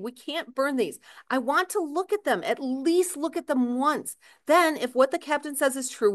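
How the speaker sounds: urgent pleading. Acted. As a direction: beg her gently but urgently. Pleading with crying wife.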